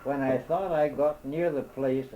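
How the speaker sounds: usual speaking voice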